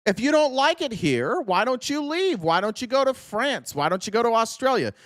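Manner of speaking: a hammed-up Tory telling rebels to leave; mock loyal colonist. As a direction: whine out the king's loyalist. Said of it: "would be like".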